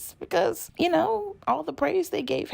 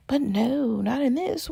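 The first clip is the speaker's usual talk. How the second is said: ordinary talk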